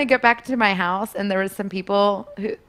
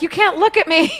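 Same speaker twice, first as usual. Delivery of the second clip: high, loud playful squeal; retold coy outburst; mock protest; 9 semitones higher, 6 dB louder than usual. Acts out her coy role-play.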